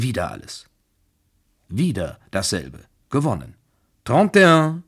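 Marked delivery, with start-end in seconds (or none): 4.0-4.8 loud, high croupier's call; Ausruf des Croupiers. A narrator's reading. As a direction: call the winning number out across the table. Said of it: "rief der Croupier". (laut und hoch ausgerufen)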